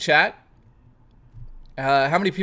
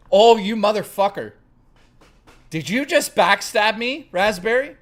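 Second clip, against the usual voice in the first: mock outrage; 7 semitones higher, 4 dB louder than usual. Mock betrayal by chat viewer.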